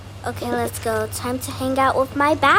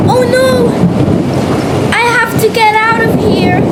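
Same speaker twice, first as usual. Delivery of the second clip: panicked; 7 semitones higher, 11 dB louder than usual. Acted. Panic in pretend scene.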